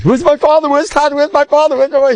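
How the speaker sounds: put-on very high, frantic little girl calling; lost princess crying out for her father, frantic; crying child. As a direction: shriek for her father in panic.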